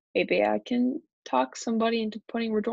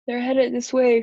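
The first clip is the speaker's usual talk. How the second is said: loud storybook alarm; warning shout; 3 semitones higher, 8 dB louder than usual. A narrator's reading. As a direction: call out a warning. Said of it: "shouted Andrea".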